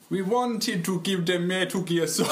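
laughing mock Jamaican accent; a Jamaican accent, cracking up. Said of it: "that Jamaican accent".

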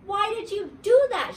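loud, high, angry holler. A narrator's reading. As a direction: demand an answer at full pitch, very angry. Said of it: "she hollered".

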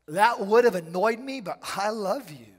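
loud, high, laughing declaration of love; joyful loud quote. Retold or imitated. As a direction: cry it out with glad abandon. Voicing a Spirit-filled believer.

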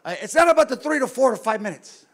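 loud, high exasperated protest; worked-up argument with his wife; heated retort. Retold or imitated. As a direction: push back hard at the wife. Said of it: "I go".

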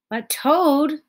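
high, loud plea; crying out. A narrator's reading. Frog crying out to Toad going back to bed. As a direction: protest as he goes back to bed. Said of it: "cried Frog".

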